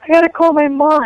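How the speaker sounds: high, mildly acted distress; retold tearful panic. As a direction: plead through mock tears. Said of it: "I was starting crying".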